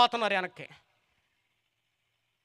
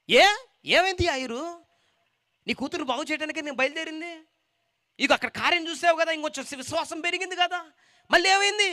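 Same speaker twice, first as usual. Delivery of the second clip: higher, surprised questioning voice; Jesus questioning; 9 semitones higher than usual. Acted. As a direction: press him kindly but firmly. (ఎత్తైన స్వరంలో ప్రశ్నించే యేసు)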